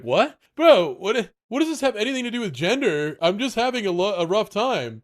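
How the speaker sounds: high, fast, baffled protest; indignant protest. Retold or imitated. Defending himself to macho Greg, high and fast. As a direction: sputter back in disbelief. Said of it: "And then I'm like".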